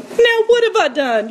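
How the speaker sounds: lightly voiced indignant kid brother; protesting younger brother. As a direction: plead your innocence to a brother. Pastor voices David's protest.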